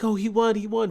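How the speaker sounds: high happy cheer; excited cheering. Retold or imitated. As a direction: cheer like the townspeople. Crowd cheering again.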